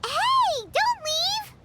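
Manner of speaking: high urgent call; calling after. Acted. Paimon yelling after the departing dragon. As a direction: yell after someone who is walking away.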